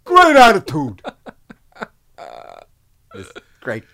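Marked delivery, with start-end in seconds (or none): 0.0-1.0 high, loud praising director; older director. Retold or imitated. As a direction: cheer on the extras like a gruff old director. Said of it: "he would say".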